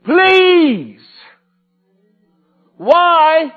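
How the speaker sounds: high, loud, slow desperate plea; pleading cry to God. Pretend. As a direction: beg God for an answer. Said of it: "to say".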